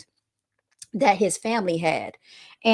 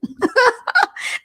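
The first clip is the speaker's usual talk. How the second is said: high mocking cackle; real laughter; 5 dB louder than usual. Real, not acted. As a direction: laugh right at the critics. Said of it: "I'm laughing at y'all".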